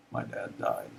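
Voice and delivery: low, soft, broken voice; retold grief, quiet and choked; sobbing. Son turns to wife Kathy, quietly broken with grief. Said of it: "sobbing and saying".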